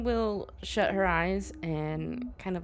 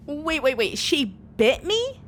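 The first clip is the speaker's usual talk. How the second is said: much higher and louder alarm; real shock; 10 semitones higher, 6 dB louder than usual. Real, not acted. Player hears the ghost bit her character, high alarmed disbelief. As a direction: blurt it out in alarm.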